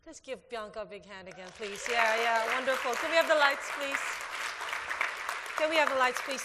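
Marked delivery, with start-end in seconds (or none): 2.9-6.4 calling out. Real, not acted. Calling to tech crew.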